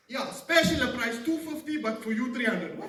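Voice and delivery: loud, high sales patter; stretched-out hawker's bargain pitch; souk vendor haggling. Acted. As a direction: sell it as a personal favour. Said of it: "what's the first thing you hear".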